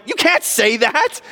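high-pitched, indignant outburst; outraged objection. Retold or imitated. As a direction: cry it out like a scandalized accuser. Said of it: "'cause they're like".

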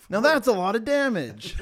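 loud laughing ad guy; Flex Seal pitchman. Pretend. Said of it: "the Flex Seal".

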